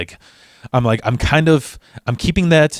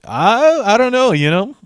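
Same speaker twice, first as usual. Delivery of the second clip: high, drawn-out feigned ignorance; stretched, noncommittal high-pitched dodge; evasive; 11 semitones higher than usual. Retold or imitated. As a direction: play innocent way up high. Dodging being recognized.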